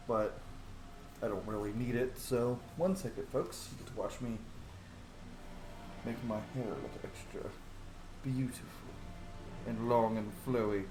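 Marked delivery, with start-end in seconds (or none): none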